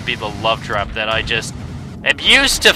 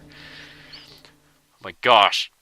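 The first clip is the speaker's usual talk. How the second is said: very slow, low drawn-out oh my gosh; exasperated sigh; 6 semitones lower, 4 dB quieter, much slower than usual. Real, not acted. Gamer worn out after five minutes getting in, slow exasperated groan. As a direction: let it drag out, worn down.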